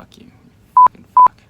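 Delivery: high, loud furious cursing; real raging yell. Real, not acted. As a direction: explode with real rage. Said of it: "I'm just kind of fucking raging right now".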